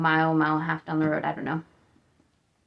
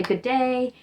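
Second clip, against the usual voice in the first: higher-pitched imitated Aussie greeting; attempted Australian accent; 6 semitones higher than usual. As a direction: lift it into a light Australian lilt. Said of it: "in an Australian accent".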